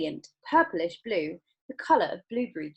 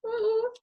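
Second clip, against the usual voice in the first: rising ooh; 3 dB louder than usual. Real, not acted. Reacting to story.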